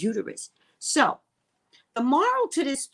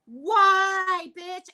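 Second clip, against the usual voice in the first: indignant retort; 10 semitones higher, 6 dB louder than usual. Retold or imitated. Retorting to doctor's warning.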